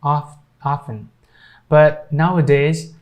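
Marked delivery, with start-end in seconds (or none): 0.0-1.1 "often" built from "off" into "offen"; silent T. Shown as a demonstration. Teacher showing the T that people stopped pronouncing in "often", silent T. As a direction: drop the T in "often". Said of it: "keeping it silent".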